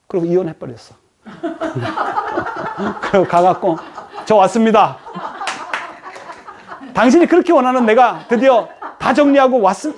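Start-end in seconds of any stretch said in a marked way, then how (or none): 1.4-3.1 high, soft burst of laughter; short amused laugh, falling away. Real, not acted. Laugh at absurd story. 4.2-9.9 giggling through a proud declaration; laughing mock announcement. Man arriving after divorce.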